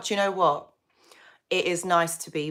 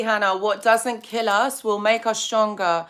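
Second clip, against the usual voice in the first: ordinary delivery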